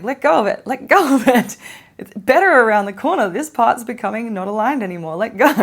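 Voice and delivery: loud, high pleading shout with a laugh creeping in; exasperated, hammed-up shouting; urgent yelling. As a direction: yell it like you're urging someone off a ledge. Universe shouts to let go.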